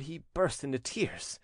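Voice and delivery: plain reading voice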